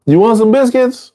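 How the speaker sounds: high, upbeat offering voice; cheerful offer. Acted. Teacher voicing a friend offering food to an addict, high and upbeat.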